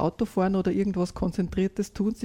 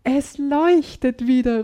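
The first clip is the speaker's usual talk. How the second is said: higher, louder, slow delight; delighted boy calling out, higher and louder; 4 semitones higher, 5 dB louder, slower than usual. A narrator's reading. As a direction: call out in wonder, higher. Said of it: "ruft er entzückt".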